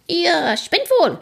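high-pitched, drawn-out outrage; indignant yelling. A narrator's reading. Dunked ferryman yells at the boaters, high and indignant. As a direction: yell back in a strained high character voice. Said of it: "schreit der Fährmann zurück".

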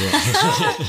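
high delighted giggle; giddy laugh. Real, not acted. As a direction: burst out laughing with joy. Host reacts to favourite artist.

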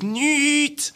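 Nichts squeaked way up high; squeaky high Nichts. Retold or imitated. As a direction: pitch the word Nichts up into a squeak. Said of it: "die Tonlage vom Nichts".

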